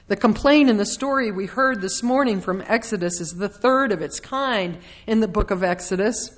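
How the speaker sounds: ordinary talk, nothing marked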